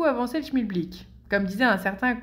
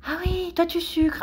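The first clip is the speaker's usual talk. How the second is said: high-pitched put-on chitchat voice; coffee-machine small talk; 7 semitones higher than usual. Colleague commenting at the coffee machine, high put-on chitchat. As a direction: play a colleague's idle remark up high.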